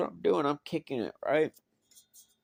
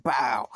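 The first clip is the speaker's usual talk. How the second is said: punch sound; 7 dB louder than usual. Retold or imitated. Imitating a blow to head.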